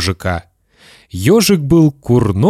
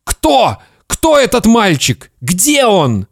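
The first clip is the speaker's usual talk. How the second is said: high, urgent demanding cries; agitated father; 10 semitones higher, slower than usual. Acted. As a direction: interrogate the boy in a panic. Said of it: "закричал папа".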